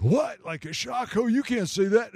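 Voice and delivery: high, loud shocked cry; shocked outburst. Retold or imitated. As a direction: blurt it out in disbelief. Shocked atheist reacts.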